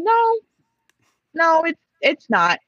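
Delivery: high-pitched, disbelieving no; retold objection. Passenger retells contradicting a man about language, high-pitched objection.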